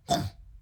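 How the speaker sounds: two rough snorted grunts; pig snort. Shown as a demonstration. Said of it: "我们怎么发出猪的声音呢".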